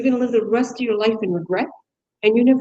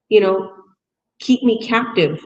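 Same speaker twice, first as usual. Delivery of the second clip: usual speaking voice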